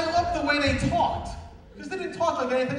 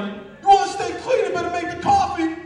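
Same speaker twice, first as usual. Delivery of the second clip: loud, higher, slogan-like delivery; mock preachy yell; 5 semitones higher, 6 dB louder than usual. Newcomer standing up to try a reversed saying, loudly proclaimed. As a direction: proclaim it, stumble, then push the punchline. Copies the rhyming yellers, botches it.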